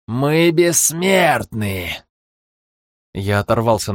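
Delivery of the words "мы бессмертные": very slow, higher villain boast; mustache twirling villain. Acted. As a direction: drag out the boast triumphantly. Villain proclaiming.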